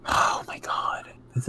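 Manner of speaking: whispered, hushed excitement; excited whisper. Real, not acted. Watching election results with her co-host, excited whisper. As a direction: whisper it like a secret thrill.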